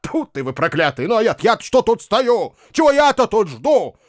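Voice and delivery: high-pitched, exasperated rant; indignant grumbling. A narrator's reading. Bakhcheev fumes over the Malaga, indignant. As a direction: build from grumble to irritated outburst. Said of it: "негодования".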